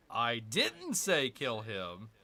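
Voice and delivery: "didn't" punched in "I didn't say kill him", slow; stress on didn't. Shown as a demonstration. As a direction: lean on "didn't". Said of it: "wherever you're emphasizing".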